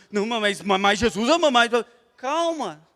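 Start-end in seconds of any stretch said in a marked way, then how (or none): 0.1-1.7 mock-nervous stumbling over words; nervous evangelizer. Nervous believer talking of Jesus, mock-flustered stumbling. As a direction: stammer it out, imitating nerves. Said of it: "ou fica nervoso".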